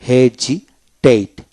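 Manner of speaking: হেজিটেট slowly split, j sound for s; S pronounced as Z. Shown as a demonstration. Teacher explaining the S rule, হেজিটেট slowly split with a j sound. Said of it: "এস এর উচ্চারণ জ হয়".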